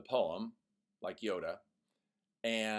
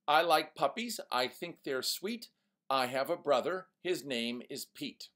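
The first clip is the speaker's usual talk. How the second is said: bad rhyme read; 5 semitones higher, 4 dB louder than usual. Shown as a demonstration. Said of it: "Here's another one".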